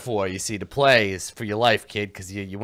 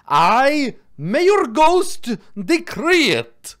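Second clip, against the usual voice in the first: high, grand ghostly decree; ghost mayor; 12 semitones higher, 6 dB louder, much slower than usual. Acted. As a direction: proclaim it like a pompous ghost.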